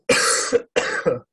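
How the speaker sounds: harsh, chesty hacking cough; coughing fit. Real, not acted. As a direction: hack out a heavy cough. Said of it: "am I dying from this cough or what?".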